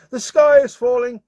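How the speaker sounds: high, loud, frantic cry; panicked shout. Acted. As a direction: shriek it like the sky is really falling. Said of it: "she started shouting".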